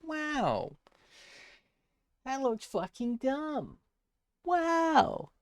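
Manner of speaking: very high cartoonish squeak; squeaky high voice. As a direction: squeal it out in a silly high pitch. Mocking own drawing.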